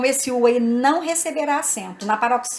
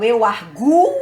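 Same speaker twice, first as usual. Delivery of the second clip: "arguo" with a long, stressed gu; 4 dB louder, slower than usual. Shown as a demonstration. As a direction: lean hard on the u in "arguo". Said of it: "tem a sílaba tônica".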